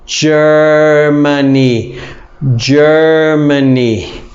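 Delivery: "Germany" stretched slowly, "jer-muh-nee". Shown as a demonstration. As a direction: draw out "Germany" for the class. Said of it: "Germany is Alemania".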